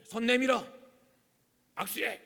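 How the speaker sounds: loud, curt mock command; parental order. Mother making the brothers shake hands, commanding tone. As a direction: force a handshake like a stern parent. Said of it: "그 다음 뭘 하죠?".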